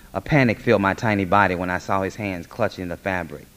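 ordinary delivery

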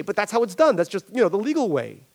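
high, hurried excuse; a hint of a squirming boss; justifying Barry. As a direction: brush it off as routine.

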